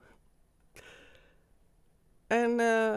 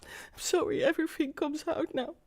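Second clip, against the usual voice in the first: overcome with emotion; 5 semitones higher, 8 dB quieter than usual. Real, not acted. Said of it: "I'm just too emotional".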